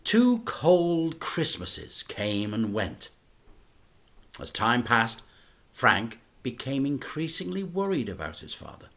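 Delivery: ordinary delivery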